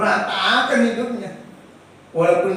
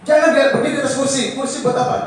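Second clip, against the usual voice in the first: sharp scolding; 6 semitones higher, 5 dB louder, faster than usual. Retold or imitated. Scolding a child on a chair.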